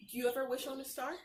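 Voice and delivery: usual speaking voice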